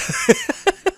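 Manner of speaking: shrieking laugh; high squeal of laughter. Real, not acted. Laughing at ugly head.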